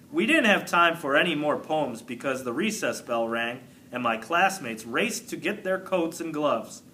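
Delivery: plain reading voice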